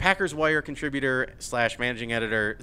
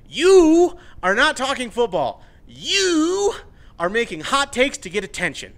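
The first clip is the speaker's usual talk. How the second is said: angry scolding; 8 semitones higher, 6 dB louder than usual. Real, not acted. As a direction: hammer the accusation home. Scolding Ryan's hot takes.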